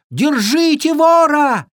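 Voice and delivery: high, loud, drawn-out cry; fawn shouting. A narrator's reading. Fawn calls for the thief's capture, shouting. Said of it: "закричал оленёнок".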